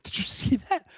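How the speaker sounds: thrilled fan at the TV; excited. Retold or imitated. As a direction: call out to the wife in delight. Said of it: "I'm like".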